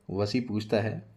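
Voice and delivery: ordinary delivery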